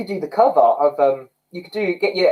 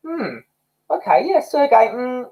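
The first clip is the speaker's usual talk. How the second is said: hesitant, higher-pitched mulling it over; unconvinced; 6 semitones higher than usual. Acted. Coach acting out a reaction to a singer, unsure. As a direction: sound politely doubtful, drawing out the verdict. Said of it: "my reaction to Sergey is".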